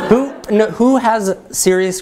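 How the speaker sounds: amused, high-pitched, laughing through it. Real, not acted. Presenter asks audience for critiques, laughing. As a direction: break into a laugh on the words. Laughs after her own blunt question.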